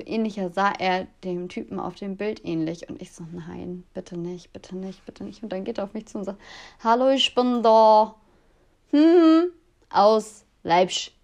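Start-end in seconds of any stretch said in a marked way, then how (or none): 3.3-5.4 hushed, pleading under her breath; a near-silent please-no, dreading him; desperate whispered plea. Retold or imitated. Panicked thought as he approaches. 6.8-11.1 imitating a slow, thick Saxon accent from Leipzig; mock the Leipzig dialect, drawn out and a bit louder; a hammed-up Saxon greeting, slow. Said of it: "das ging mit dem Akzent nicht".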